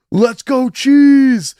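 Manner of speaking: loud, high, drawn-out cheer; crowd shout. Retold or imitated. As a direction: holler it out like a hyped friend. Friend's shout to the crowd, retold.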